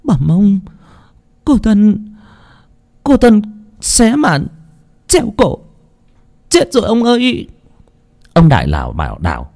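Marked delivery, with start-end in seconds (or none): 0.0-7.5 frantic report. Acted. Cook reporting to her master that the girl hanged herself, high and shaken. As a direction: report the death, barely holding together.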